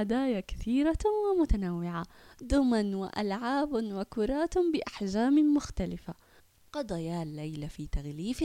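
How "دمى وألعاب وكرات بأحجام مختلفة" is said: plain reading voice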